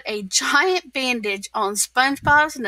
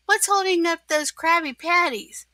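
raised voice, calling an employee; lightly acted boss's yell; mild mock yell; 6 semitones higher than usual. A narrator's reading. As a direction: call out impatiently, without shouting. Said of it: "yelled Mister Krabs".

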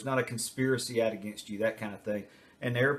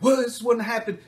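put-on much higher, louder yelling kid; mock angry outburst; 10 semitones higher, 6 dB louder than usual. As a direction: lash out at the parent. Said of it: "they just go crazy".

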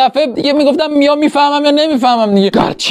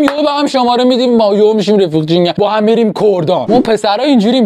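ordinary talk

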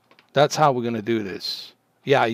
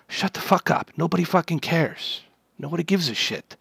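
ordinary talk

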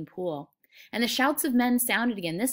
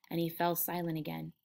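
usual speaking voice

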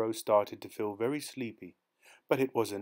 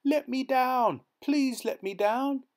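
high, slow begging cries; desperate pleading; 14 semitones higher, 4 dB louder, slower than usual. A narrator's reading. Dangling youth begs, high and slow. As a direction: beg to be let down. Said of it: "our hero cried".

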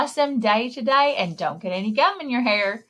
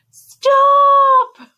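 slow, louder, emphatic call; dramatic shout; 9 dB louder than usual. Acted. Storyteller building up the firemen's rescue, shouting. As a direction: announce the firemen's arrival big.

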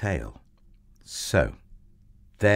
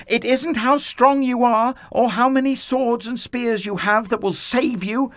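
put-on high, loud boy's proclamation; defiant boy hero; 14 semitones higher, 5 dB louder than usual. A narrator's reading. David declares God's victory to the giant, high ringing shout. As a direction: call it out high with full conviction, building louder.

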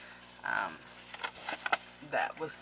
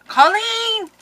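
excited squeal; 10 dB louder than usual. Real, not acted. Gasps at sender's name.

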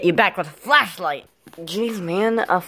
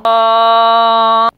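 loud, high, steady "uhhh"; long held yell; 5 semitones higher, 9 dB louder than usual. Acted. Cup teleports him somewhere random, long loud yell. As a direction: hold one loud high note without wavering.

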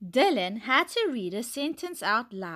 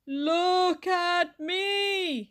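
high, drawn-out astronaut call; slow-motion cry; 8 semitones higher, much slower than usual. A narrator's reading. Dylan playing astronaut in super slow motion, crying out slowly. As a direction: stretch every word as if moving in slow motion. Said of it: "he cried".

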